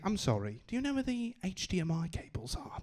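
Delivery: put-on a gentle British-accented request; posh British accent. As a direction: ask sweetly in a British accent. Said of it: "I don't use this voice".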